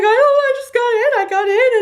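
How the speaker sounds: very high, hurried squeal; emotional, tearful. Retold or imitated. Frantic daughter on phone with mom, tearful squeal. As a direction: blurt it out in a mock-crying wail. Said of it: "I was so emotional".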